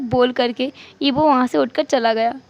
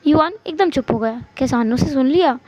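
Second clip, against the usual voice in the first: ordinary delivery, nothing marked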